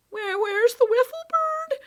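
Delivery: high, loud worried call; anxious. Acted. Boy looking for his bird after the attack, anxious. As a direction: search for her frantically. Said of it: "looked for her anxiously".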